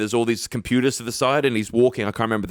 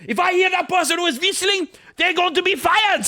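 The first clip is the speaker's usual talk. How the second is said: put-on high, stern German-accented whisper-yell; Christoph Waltz impression. A German actor demanding who is whistling on set. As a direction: snap the question like an angry director. Waltz threat in retold story.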